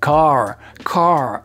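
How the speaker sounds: "car" in an American accent with the R clearly sounded. Shown as a demonstration. Teacher showing American "car" with its R. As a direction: curl the tongue back on the R of "car". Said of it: "Si on a un accent américain".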